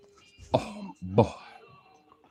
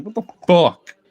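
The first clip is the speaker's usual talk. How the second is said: indignant, louder and higher, voicing the dog; mock mad; 5 semitones higher, 7 dB louder than usual. Robbed dog to the bone thief, mock outrage. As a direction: play the affronted victim, punching it out. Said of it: "looking at him like mad".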